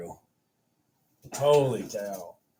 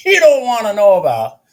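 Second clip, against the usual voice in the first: laughing; 13 semitones higher, 11 dB louder than usual. Real, not acted. Laughing warning about trading stress.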